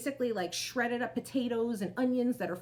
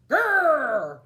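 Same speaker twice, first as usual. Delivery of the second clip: loud growling bear roar; 4 semitones higher, 11 dB louder than usual. Acted. Old Bear growling at Baba Brena, loud bear growl. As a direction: growl big for the kids to join. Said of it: "growled Old Bear".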